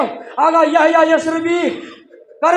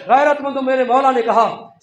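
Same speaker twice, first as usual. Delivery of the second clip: ordinary talk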